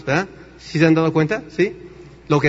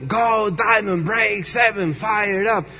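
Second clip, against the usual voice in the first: higher-pitched imitation rally chant; hammed-up convention cheer; mock gringo shouting; 4 semitones higher, much slower than usual. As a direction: imitate excited Americans yelling slogans, without full volume. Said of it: "todos los gringos allá gritando".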